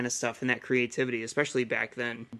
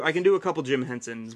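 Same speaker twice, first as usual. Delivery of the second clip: ordinary talk, nothing marked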